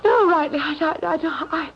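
high, trembling, near tears; badly shaken after a near fall. Shaken after nearly falling.

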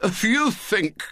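high, slow, strangled outrage; indignant boyish disbelief; William, hoarse indignation. A narrator's reading. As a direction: begin hoarsely, aghast. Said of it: "hoarse with indignant surprise".

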